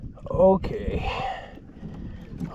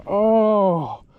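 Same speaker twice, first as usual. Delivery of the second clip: usual speaking voice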